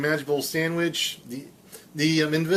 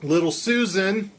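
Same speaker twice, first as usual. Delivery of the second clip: normal talking voice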